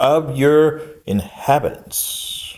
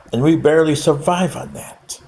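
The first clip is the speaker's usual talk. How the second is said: normal talking voice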